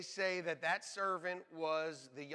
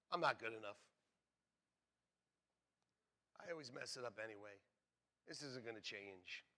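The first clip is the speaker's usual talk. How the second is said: lower, softer defeated murmur; dejected self-talk; 7 semitones lower, 9 dB quieter than usual. Retold or imitated. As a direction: give up on yourself quietly. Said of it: "In other words".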